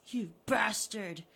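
loud, slow, spat out; angry curse. Acted. Harry cursing Richard, loud and angry. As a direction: spit it at him.

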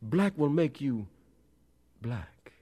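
high, rhythmic pulpit call; fervent preaching. A narrator's reading. Preacher warning his congregation about blackness, fervent. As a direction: pitch it up like a sermon refrain.

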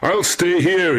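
high, long-carrying call; shouting. A narrator's reading. Young man shouting to an unseen voice, high call. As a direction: call it out across a distance. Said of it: "he shouted".

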